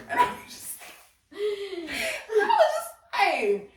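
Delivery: high, slow laugh through the words. Real, not acted. Laughing as she tells her co-host about her mom's joke. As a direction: giggle it out.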